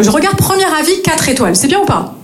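normal talking voice

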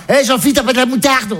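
high-pitched mum calling out; imitated mother. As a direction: call across as the high-voiced mother. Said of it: "elle était comme à la maison". (maman imitée qui appelle, voix haute)

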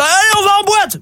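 rally the lads for a night out; mock boisterous cheer; rowdy rallying call. Rugby mates rally to go clubbing.

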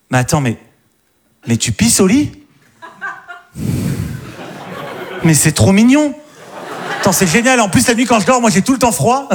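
put-on high, delighted girlfriend voice; girly voice. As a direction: coo it like a charmed girlfriend. Girl voiced reacting sweetly. (voix de copine attendrie)

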